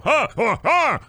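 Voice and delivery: high startled huffs; mock startled gasps. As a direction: gasp in mock fright. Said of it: "I'm gonna go".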